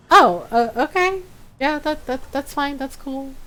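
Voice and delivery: hesitant, placating mock unease; mock backing down. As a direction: back off meekly in a small voice. Acted backing down before defiant Automod.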